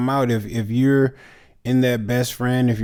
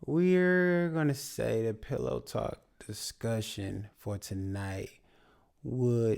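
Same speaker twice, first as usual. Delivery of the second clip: sleepy voice; 6 dB quieter than usual. Pretend. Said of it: "we doing our sleepy voice".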